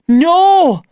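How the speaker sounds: high, loud desperate cry; frightened boy shouting no at the fight. Acted. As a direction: yell it to stop them. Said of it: "I shouted".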